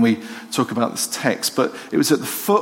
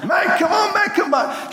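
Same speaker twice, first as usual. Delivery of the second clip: shouting my head off. Retold or imitated. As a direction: yell for the lost dog to come back. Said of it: "Shouting my head off".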